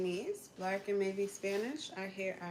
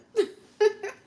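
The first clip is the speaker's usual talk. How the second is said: loud laugh; 14 semitones higher, 12 dB louder than usual. Real, not acted. Laughing at accent remark.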